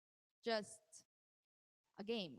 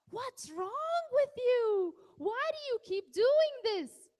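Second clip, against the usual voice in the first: much higher and louder, slow, exasperated yell; angry yelling; 11 semitones higher, 11 dB louder, much slower than usual. Shown as a demonstration. Parent losing patience with a misbehaving child, angry shout. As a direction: bellow it like a fed-up parent. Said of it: "Don't say".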